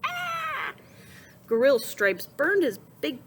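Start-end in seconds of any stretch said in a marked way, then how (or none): 0.0-0.7 pained yelp. A narrator's reading. Boar burned on grill.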